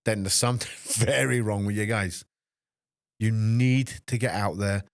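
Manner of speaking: normal talking voice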